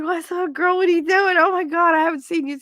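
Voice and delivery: high, gushing retold hello; excited greeting. Principal catching up with his teacher friend, excited greeting. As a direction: gush at a long-missed friend.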